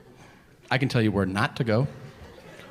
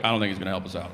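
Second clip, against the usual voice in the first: whitest detective; 6 semitones lower, much faster than usual. Retold or imitated. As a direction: mutter it like a timid office detective. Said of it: "the whitest detective".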